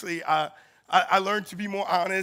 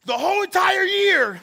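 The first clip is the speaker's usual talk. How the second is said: high, loud shouted demand; mom's furious shout; 11 semitones higher, 8 dB louder than usual. Retold or imitated. Furious mother shouting at the principals, high and loud. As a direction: yell it like an outraged mother.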